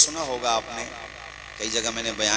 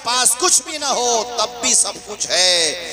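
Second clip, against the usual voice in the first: high, slow, loud solemn sermon line; solemn preaching; 13 semitones higher, 5 dB louder, slower than usual. Acted. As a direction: deliver the moral slowly and grandly. Slow solemn climax over music. (آہستہ پُرجلال وعظ)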